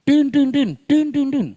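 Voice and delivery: imitating a honking horn; horn honks. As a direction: honk like an angry driver's horn.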